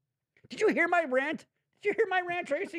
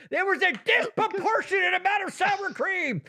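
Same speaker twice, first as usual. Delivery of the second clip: grand proclamation; 10 semitones higher than usual. Shown as a demonstration. Host repeating an unprecedented phrase to his co-host, grand and high. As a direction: say it high and grand, like a historic first. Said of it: "No one's ever put those words together".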